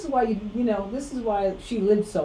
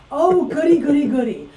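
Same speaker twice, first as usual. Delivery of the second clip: excited; 7 semitones higher, 7 dB louder than usual. Acted. Gleeful mock excitement over apology.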